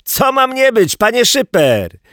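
high, loud call up from underneath; boy's high-pitched holler; voice from below. A narrator's reading. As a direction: shout it up to someone overhead. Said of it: "odezwał się głos wydobywający się spod spodu".

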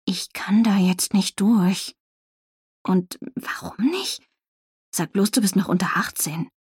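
hushed secret whisper. Acted. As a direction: whisper so the police can't hear. Heimliches Gespräch neben Polizei. (flüsternd und heimlich)

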